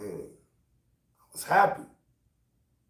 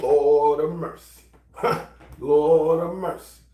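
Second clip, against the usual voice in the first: slow, louder, drawn-out lord of mercy; exclamation; 6 dB louder than usual. Retold or imitated. Man delighting as his phone blows up, slow and loud. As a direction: savor it like a joyful groan.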